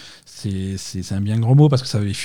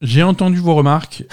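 fake apology; 6 semitones higher, 3 dB louder than usual. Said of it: "Yves Guillemot s'est depuis excusé".

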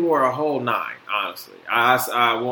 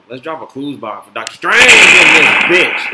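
hyped shout; 12 dB louder than usual. Real, not acted. Hyped call to action.

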